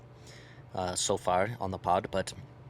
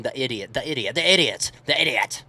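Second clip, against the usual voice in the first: emphatic; 3 semitones higher, 7 dB louder than usual. Real, not acted. Emphatic repetition, raised.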